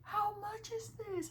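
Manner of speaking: high, slow sweet question; high, slow, smitten shopper voice; gushing customer. Retold or imitated. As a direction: ask it all charmed and drawn out.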